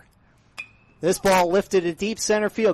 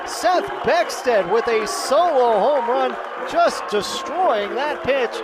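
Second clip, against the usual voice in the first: louder, higher and building as the ball carries; excited home run call; 3 semitones higher, 5 dB louder than usual. Real, not acted. Radio announcer calling a home run for listeners, excited. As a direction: ride the ball over the fence.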